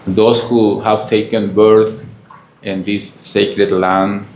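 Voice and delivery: usual speaking voice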